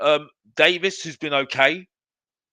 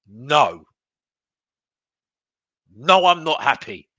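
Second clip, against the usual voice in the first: firm refusal; 3 semitones lower, 6 dB louder, slower than usual. Retold or imitated. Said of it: "every single right to say".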